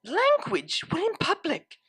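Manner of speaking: refined mare's scolding; prim scolding. Acted. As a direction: chide her like a stiff aristocrat. Octavia scolding primly.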